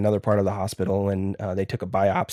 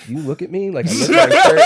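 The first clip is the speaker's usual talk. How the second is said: laughing; 7 semitones higher, 8 dB louder, faster than usual. Retold or imitated. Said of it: "and I was like".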